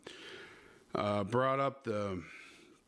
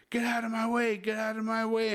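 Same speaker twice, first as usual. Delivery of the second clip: yelling; 11 semitones higher than usual. Retold or imitated. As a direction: bark it out to get past her. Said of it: "And I start yelling at her".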